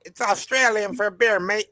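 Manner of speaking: put-on a Crocodile Dundee-style Australian accent selling beer. Quoting the Foster's beer ad to friends on stream in an Australian accent. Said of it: "do that Australian accent again".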